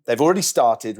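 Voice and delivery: usual speaking voice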